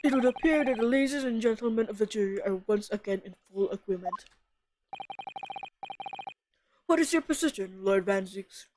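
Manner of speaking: a hint of a formal old judge; lightly voiced stately judge.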